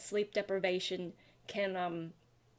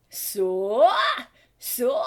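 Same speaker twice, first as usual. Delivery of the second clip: gritty scream; 2 semitones higher, 9 dB louder than usual. Shown as a demonstration. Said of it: "if my voice can even achieve that".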